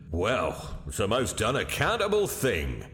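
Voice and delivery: ordinary delivery, nothing marked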